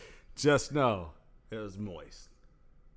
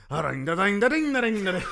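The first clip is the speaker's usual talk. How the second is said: bell ding; 9 semitones higher, 5 dB louder than usual. Acted. Mimicking fight bell.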